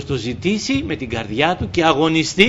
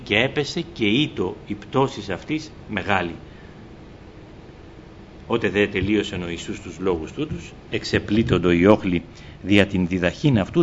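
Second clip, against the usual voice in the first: measured liturgical reading voice; scripture reading; 6 semitones lower than usual. Acted. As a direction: read the Gospel aloud to the congregation. Reads scripture before sermon.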